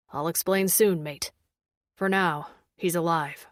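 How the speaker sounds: low, measured male character voice; grave alien man. A narrator's reading. Daz answers after deep sigh.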